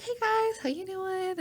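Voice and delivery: bubbly greeting. Retold or imitated. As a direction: chirp a cheerful hello. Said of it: "I was like".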